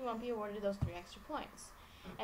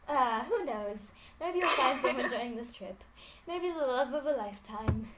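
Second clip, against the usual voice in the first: campy drag queen; 7 dB louder than usual. Acted. Voicing Laura teasing about love.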